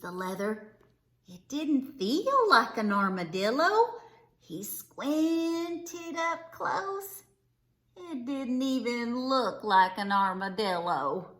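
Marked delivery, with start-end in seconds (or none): none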